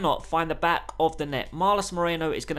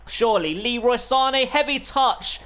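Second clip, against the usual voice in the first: higher and louder, pleading; excited call; 7 semitones higher, 5 dB louder than usual. Real, not acted. Gamer urging his striker to score, excited call. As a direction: beg for the goal as he shoots. Last attack before half time.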